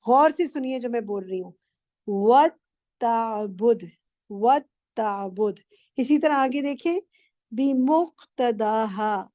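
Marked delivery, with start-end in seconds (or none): 2.1-5.6 "ताबुद" with a doubled, held ta, said slowly. Shown as a demonstration. Teacher stresses the shadda on ta for the student. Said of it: "ता पे तशदीद है". 7.5-9.3 "बिमुक्तदाहा" slow, the meem clearly voiced; sound the meem. Shown as a demonstration. Teacher points out a missed meem and models the word. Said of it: "मीम miss कर दिया था".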